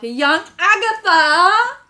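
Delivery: rising high, loud exclamation; loud dramatic reveal. Pretend. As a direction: build to a high, belted name. Teasing dramatic exclamation of name.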